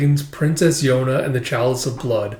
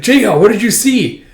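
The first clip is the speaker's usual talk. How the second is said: loud, raised acted call; 9 semitones higher, 6 dB louder than usual. Companion calling up to the flier, loud and high.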